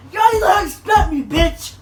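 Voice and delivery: high, loud mocking yell; mock shout. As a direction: bellow it back at him in mockery. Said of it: "yell at me like".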